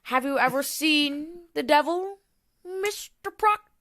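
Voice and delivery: high, hammed-up Crucible interrogator; mock-solemn Crucible line, pitched high; Crucible accuser. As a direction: play a Salem judge grilling Proctor. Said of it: "Uh, The Crucible".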